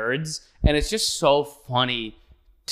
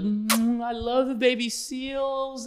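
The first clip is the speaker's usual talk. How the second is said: high slow cooing; cooing over seals; 10 semitones higher, slower than usual. Retold or imitated. Host cooing at baby seals, high slow mock tenderness. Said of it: "kissing baby seals".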